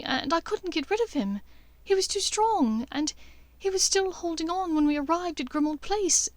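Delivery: Hermione explains, afraid of doing harm; worried Hermione. Acted.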